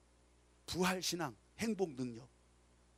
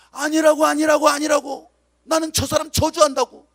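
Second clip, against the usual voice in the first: loud, desperate shouting denial; frantic denial; 5 semitones higher, 11 dB louder than usual. Acted. Peter, cornered by bystanders, shouts his denial loudly in panic.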